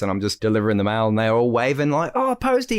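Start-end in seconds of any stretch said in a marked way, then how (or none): 2.2-2.8 high sing-song hello; cheery kids greeting. Retold or imitated. Schoolkids waving at the postman, high sing-song call.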